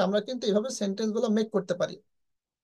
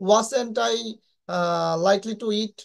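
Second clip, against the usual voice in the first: very slow model phrase; much slower than usual. Shown as a demonstration. Teacher models example sentence.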